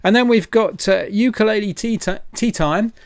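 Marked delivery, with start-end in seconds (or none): none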